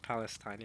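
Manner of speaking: Palestine ending in "-stine"; wrong pronunciation. Shown as a demonstration. Streamer rejecting the clip's Palestine ending "-stine". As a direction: say Palestine as PAL-es-tine, long i. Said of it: "It's not pronounced".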